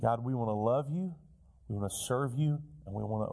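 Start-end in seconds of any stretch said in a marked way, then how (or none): none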